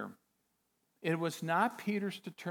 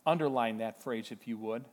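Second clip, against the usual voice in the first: ordinary talk, nothing marked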